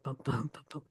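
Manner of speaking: soft "bum, bum, bum, bum" as weak-STRONG pairs; "bum, bum, bum, bum" with every second beat stressed; iambic meter. Shown as a demonstration. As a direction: tap out bum BUM, bum BUM quietly. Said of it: "talk in iambic meter".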